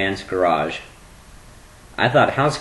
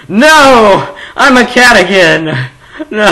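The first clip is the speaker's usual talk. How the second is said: loud, high hammed-up cry breaking into laughs; mock panicked shout; 11 semitones higher, 12 dB louder, slower than usual. Recalling the helicopter day, mock panic. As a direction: scream it like a silly nightmare. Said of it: "and it was like".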